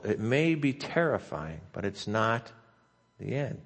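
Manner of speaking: rising preacher cadence; preaching. Acted. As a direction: preach it, building and landing each line. Opening of sermon, preaching cadence.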